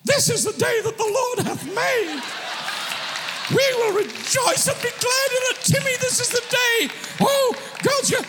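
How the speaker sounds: hammed-up sing-song enthusiasm for the day; chirpy morning wife. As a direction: imitate the wife bouncing up full of joy. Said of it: "Jackie's up and going".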